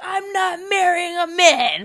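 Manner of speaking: laughing declaration. Retold or imitated. Daughter telling mom she won't marry a man, laughing. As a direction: blurt it while laughing. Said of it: "I was like".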